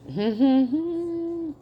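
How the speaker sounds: amused little laugh; a quick burst of laughter; short high laugh. Real, not acted. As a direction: giggle at the thought. Laughs at assassination attempts.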